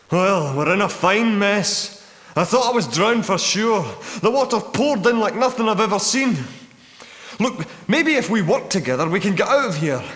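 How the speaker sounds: high-pitched, worked-up delivery; rally them after a near disaster; agitated. Acted. Man who nearly drowned urging teamwork, agitated.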